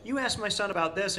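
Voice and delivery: high-pitched imitation of a delighted recognition; excited recognition. Mother recalling her son's interview to the broadcaster, excited. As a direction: lift the voice as the retold mother.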